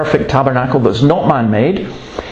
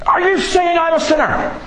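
retold heckler yell. Heckler at the back of the room challenging the preacher, mock outrage. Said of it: "he yells at me".

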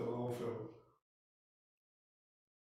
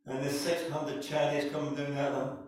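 ordinary talk

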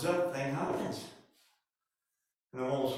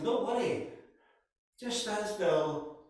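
raised pitch, drawn-out slow "Just stand still"; emphatic command; 6 semitones higher, slower than usual. Acted. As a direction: plant each word firmly. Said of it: "So Moses says".

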